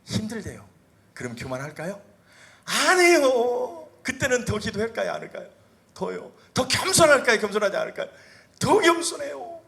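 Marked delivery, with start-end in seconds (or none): 2.6-9.6 high, loud, impassioned sermon; preaching at full force. Acted. Pastor urges the congregation, impassioned loud preaching. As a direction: drive the point home, ringing out.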